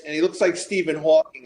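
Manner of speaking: ordinary talk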